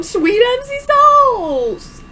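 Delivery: high, slow drawn-out cry; exaggerated exclamation. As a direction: ham up the surprise.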